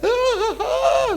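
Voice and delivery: high whiny ah ah ah, far above his voice; mock whining. Preacher mimics a wife nagging her husband, high whining. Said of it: "Itu pria kalau direngek-rengek".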